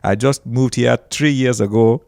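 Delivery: newly arrived Nigerian immigrant's accent. Shown as a demonstration. Comedian posing as a newcomer from Africa, Nigerian accent. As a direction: keep up a put-on Nigerian English. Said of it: "I'm talking just like this".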